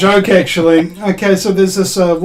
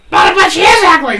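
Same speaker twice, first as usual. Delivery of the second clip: high, louder protesting parrot; the parrot talks back to the shop clerk; cockatoo voice; 12 semitones higher, 4 dB louder than usual. Retold or imitated. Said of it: "has a word with the cockatoo".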